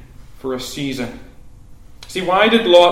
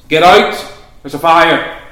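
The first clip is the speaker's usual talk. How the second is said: loud, raised shout of warning; fire-alarm shout; 8 dB louder than usual. Acted. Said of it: "I shout to you".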